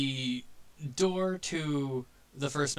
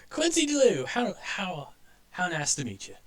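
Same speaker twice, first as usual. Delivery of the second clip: introduces himself high, then settles; charming toon, starting high and easing off; high cartoon porcupine; 7 semitones higher, 5 dB louder than usual.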